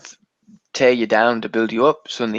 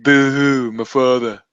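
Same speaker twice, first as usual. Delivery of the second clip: mock boo-hooing; 4 dB louder, slower than usual. Co-host mocking Jon Snow, mock boo-hoo. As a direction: fake sob story, louder opening.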